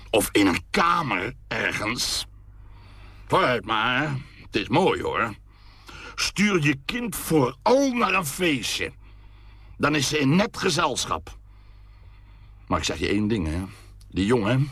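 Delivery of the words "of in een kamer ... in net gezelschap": much higher, slow mocking father voice; mock the mother's choices, slowly; sarcastic tirade. A narrator's reading. Father mocks his wife for sending the girl out, high and sarcastic.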